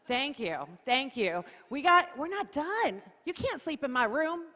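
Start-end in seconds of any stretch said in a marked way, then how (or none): none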